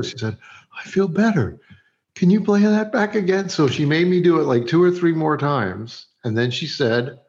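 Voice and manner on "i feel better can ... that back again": higher, hopeful imitated voice; imitating cheered-up actress. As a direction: mimic her perking up, pitched high.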